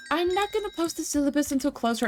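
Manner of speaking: high mocking voice of the professor; sneering mock professor impression. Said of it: "he's like".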